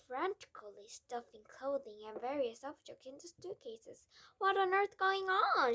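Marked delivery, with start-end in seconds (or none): 4.4-5.7 put-on high, loud girlish cry; a startled child crying out. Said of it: "she cried".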